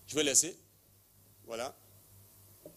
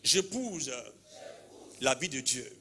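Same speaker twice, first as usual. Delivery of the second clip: prayer declaration. Real, not acted. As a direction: lead the crowd line by line. Répétez après moi.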